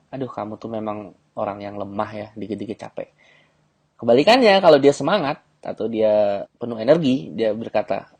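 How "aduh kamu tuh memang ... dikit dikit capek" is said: soft teasing. Shown as a demonstration. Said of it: "tuduh dia lemah".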